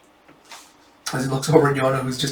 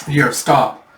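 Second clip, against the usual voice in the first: plain reading voice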